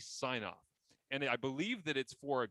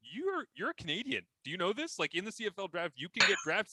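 excited news; 7 semitones higher than usual. Retold or imitated. Darren telling Jake Bird he's Canadian, excited. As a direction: break the news to him with delight. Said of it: "letting Jake Bird know".

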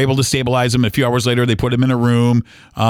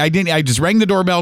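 normal talking voice

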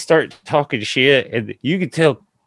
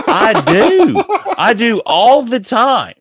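laughing reply; 13 semitones higher, 6 dB louder, slower than usual. Retold or imitated. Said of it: "And I was like".

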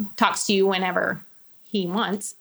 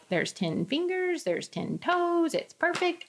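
gentle reassurance; 7 semitones higher, 9 dB quieter, slower than usual. Retold or imitated. Sonogram staff telling good news.

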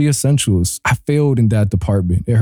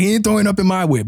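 quick, high-pitched mock celebration; hammed-up giddy boast; gleeful relief; 8 semitones higher, faster than usual. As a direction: rattle it off high and triumphant. Re-enacting his relieved celebration.

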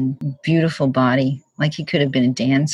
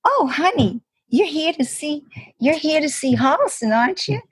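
campy Southern "oh, honey" welcome; Southern accent; 9 semitones higher than usual. Retold or imitated. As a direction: play a sweet Southern assistant greeting her. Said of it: "this young guy with a Southern accent".

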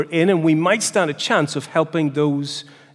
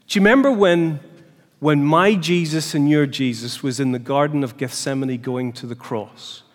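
ordinary delivery

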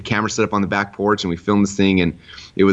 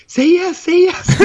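very high, louder mock squeal; excited urging; 5 dB louder, much slower than usual. Retelling wife on the bed urging him, excited. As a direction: imitate someone bouncing as they beg you to agree. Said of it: "jumping up and down in the bed".

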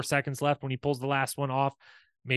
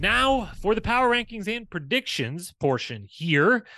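slow, high and loud segment announcement; hosting a recap podcast, booming segment intro; announcer intro; 7 semitones higher, 4 dB louder than usual. Acted. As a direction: announce the next segment like a show host.

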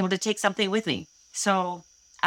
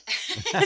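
high burst of laughter; 12 semitones higher than usual. Real, not acted. Guest laughs at question.

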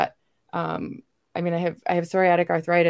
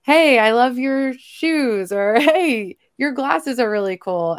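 much higher and louder, bright cheery call; bubbly compliment; 7 semitones higher, 5 dB louder, slower than usual. Retold or imitated. Woman greeting a stranger with a compliment, high and loud. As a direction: chirp a friendly compliment to a stranger. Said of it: "I'm the kind of person that's like".

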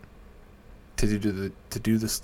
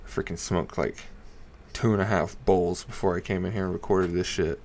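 usual speaking voice

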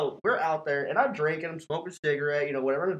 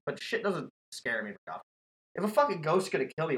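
usual speaking voice

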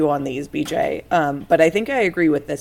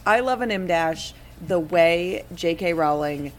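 ordinary delivery

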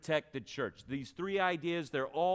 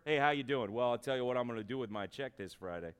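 lightly voiced worker making small talk; casual, low-key impression of a bored employee; a hint of a paycheck-minded coworker; clock-punching worker; 5 semitones lower than usual. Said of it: "talk like this at work".

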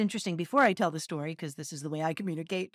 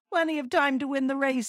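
happily; 6 semitones higher, 4 dB louder than usual. Acted. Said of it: "said the hare to himself happily".

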